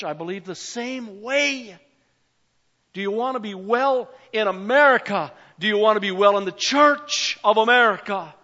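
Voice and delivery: building, loud preacher's appeal; fervent preaching. Acted. Pastor presses the church to answer God, preaching loudly. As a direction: rouse the congregation, voice climbing. Preaching rises to full force.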